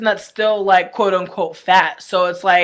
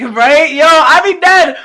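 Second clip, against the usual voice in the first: loud, high, cracking up while talking; genuinely tickled, loud laughing delivery; real laughing; 8 semitones higher, 10 dB louder, slower than usual. Real, not acted. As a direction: laugh through the words, pitch and volume climbing. Amused by engagement photos.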